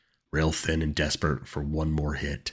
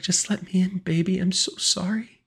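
very high, tearful begging; high-pitched weepy mother; crying plea; 3 dB louder than usual. A narrator's reading. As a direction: beg as the voice breaks. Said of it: "Her voice broke when she started crying".

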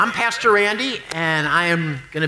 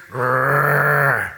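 dog growl; 5 semitones lower than usual. Acted. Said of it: "great big snarling Rottweiler".

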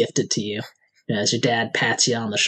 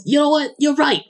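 excited agreement; 13 semitones higher than usual. Acted. NPC egging on, high excited.

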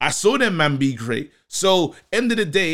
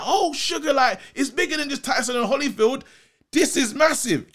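amazed fan; 9 semitones higher than usual. Retold or imitated. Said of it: "You're like".

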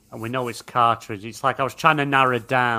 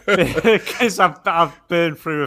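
laughing; 5 semitones higher, 8 dB louder, slower than usual. Real, not acted. Laughing at own memory lapse.

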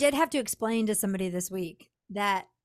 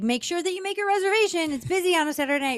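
higher-pitched, urgent motherly reminder; nagging mom; 7 semitones higher, faster than usual. Retold or imitated. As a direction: remind him like a fussing mom. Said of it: "used to act like his mom".

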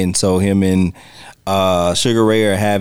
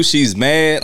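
ordinary talk

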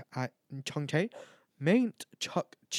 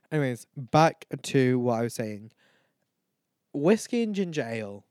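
usual speaking voice

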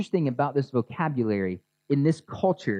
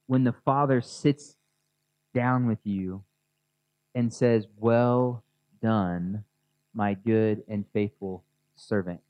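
guided meditation; 2 semitones lower than usual. Acted. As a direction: lead them gently through the picture. Pastor guides congregation, eyes closed.

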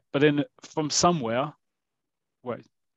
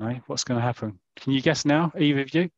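ordinary delivery

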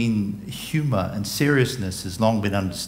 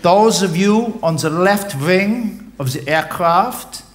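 much higher, louder loudspeaker announcement, laughing through it; captain in a boat addressing the stranded passengers; 9 semitones higher, 5 dB louder than usual. Acted. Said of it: "he has a loudspeaker".

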